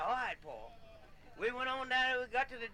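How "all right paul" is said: low meek reply. Retold or imitated. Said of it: "I said".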